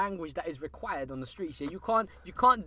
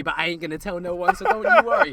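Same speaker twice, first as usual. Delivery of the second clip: mock reassuring shout; 10 dB louder than usual. Teasing mock reassurance, shouted end.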